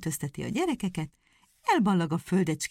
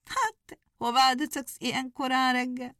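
high, sneering hare voice, much higher; mocking hare; 7 semitones higher than usual. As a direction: scoff at the hedgehog from up high. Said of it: "kérdi nagy gúnyosan".

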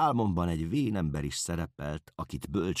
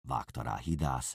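usual speaking voice

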